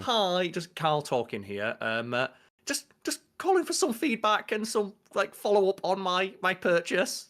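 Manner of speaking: high, slow, non-aggressive phone manner; polite phone voice. Acted. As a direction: tiptoe politely through a follow-up call. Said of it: "don't wanna sound too aggressive on the phone".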